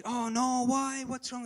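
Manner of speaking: hammed-up worried reply; mock panic. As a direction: act out a startled friend. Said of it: "I thought he'd say".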